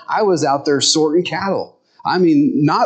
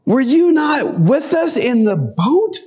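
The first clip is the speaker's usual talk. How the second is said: high-pitched, exasperated "duh" voice; incredulous; 12 semitones higher, slower than usual. Acted. Disciples looking at Jesus after the storm, incredulous. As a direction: challenge him high and disbelieving. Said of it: "they're looking at Jesus and they're going".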